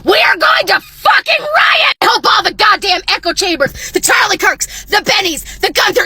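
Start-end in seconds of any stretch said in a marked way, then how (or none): none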